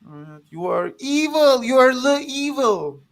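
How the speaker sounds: a loud, put-on accusing voice; accusing villain voice. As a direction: denounce him as pure evil.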